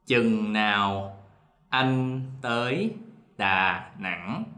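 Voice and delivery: slow, careful southern Vietnamese reading; southern Vietnamese dialect. Shown as a demonstration. Teacher demoing the southern Vietnamese dialect, slowly. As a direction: read it in the Saigon southern accent, word by word. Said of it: "I'm gonna read, uh, the sentence in our dialect first".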